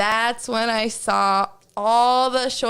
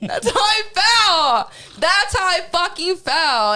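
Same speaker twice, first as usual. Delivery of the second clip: high, slow, louder mock wail; mock sobbing; 8 semitones higher, 4 dB louder, slower than usual. Telling friend about lost hair, hammed-up sobbing wail. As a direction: ham up crying over your hair.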